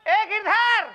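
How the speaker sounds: calling out. Acted. Partner summons the missing men by name, calling out. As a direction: holler the names, stretching them out.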